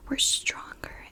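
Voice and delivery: ordinary talk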